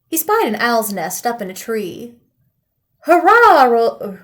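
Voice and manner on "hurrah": loud, high cheer; joyful shout. A narrator's reading. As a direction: burst out with a big happy cheer. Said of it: "Rollo shouted".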